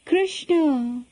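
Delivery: despairing cry. A narrator's reading. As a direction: call the name as if clinging to it. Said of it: "It was a despairing cry".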